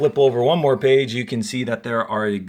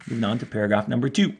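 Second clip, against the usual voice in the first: usual speaking voice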